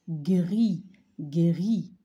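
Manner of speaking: Gris loud, the gr rolled clearly; model word. Shown as a demonstration. Teacher drilling Gris for the class. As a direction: punch out Gris with a firm gr. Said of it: "Donc, on entend G".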